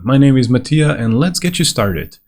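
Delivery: ordinary talk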